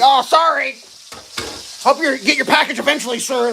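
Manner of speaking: high, loud fake-polite brush-off; mock customer service rep. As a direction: parody a dismissive support agent. Mocking customer service reps.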